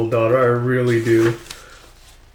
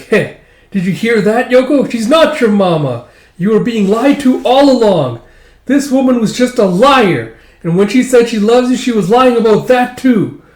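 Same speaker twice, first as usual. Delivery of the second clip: loud higher mocking gloat; taunting; 9 semitones higher, 7 dB louder than usual. [name] taunting Yoko that Mama lied, loud mocking voice. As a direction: rub the truth in cruelly.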